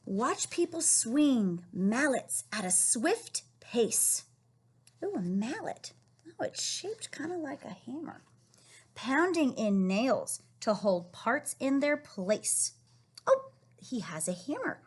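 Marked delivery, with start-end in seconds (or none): 0.1-4.2 slow storybook reading; reading to children. Acted. Reading the book text. 8.9-12.7 slow storybook reading; reading to children. Acted. Reading the book text.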